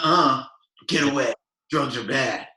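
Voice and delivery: put-on loud, prim teen refusal; hammed-up innocent kid saying no; mock goody-goody. Parking lot kid mock-refusing a customer's weed tip, prim and loud.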